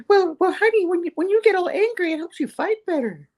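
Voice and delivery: very high falsetto wifey voice; Typhoid. Acted. Wife answering her mad husband, mock-sweet falsetto. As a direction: coo it in an exaggerated falsetto. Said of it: "and she's like".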